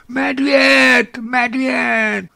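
high, loud terrified yell of bear; panicked yelling. A narrator's reading. Hunter screaming warning at others, loud panic. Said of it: "a začal řvát".

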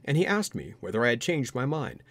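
usual speaking voice

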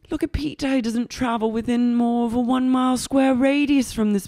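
high, mock-marvelling; put-on boss. Boss showing waitress her future, high mock wonder. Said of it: "She goes".

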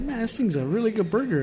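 impressed diner. Retold or imitated. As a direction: act out being pleasantly impressed mid-bite. Retells being impressed by burger.

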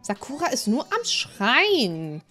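ordinary talk

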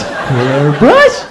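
baffled repeat with a laugh; puzzled and amused by the word; mock clueless customer; dumb questioning. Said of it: "here I'm going".

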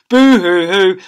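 mock boo-hoo. Narrator mocks the weeping.

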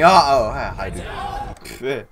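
sudden startled hello; startled greeting. Real, not acted. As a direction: caught off guard by the enemy. Enemy suddenly right below him.

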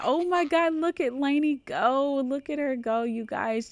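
high, loud delighted squeal; thrilled. Acted. Mother watching her daughter ride, squealing with delight. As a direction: gush over her kid's first ride.

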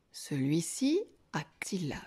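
ordinary delivery

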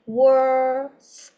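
"Worst" stretched out, long vowel before the final st; slow practice model. Shown as a demonstration. Teacher helping An practise, very slow "Worst" with separated ending. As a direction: draw out "Worst" sound by sound. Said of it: "An cứ tập từ từ nha".

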